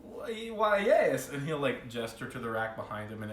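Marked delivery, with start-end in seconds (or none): 0.0-1.3 higher, delighted merchant; a hint of an eager shopkeeper; lightly voiced dwarf shopkeeper.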